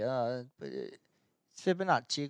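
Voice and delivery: slow Italian-style "Fibonacci", drawn out; Italian pronunciation. Shown as a demonstration. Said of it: "we were saying the name wrong forever".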